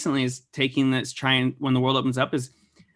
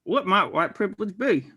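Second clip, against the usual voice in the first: a light, hammed-up Southern accent, pitched up; put-on Southern accent; 7 semitones higher than usual. Cohost asks Sarah about privilege in a mock Southern accent. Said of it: "I had to do an Southern accent".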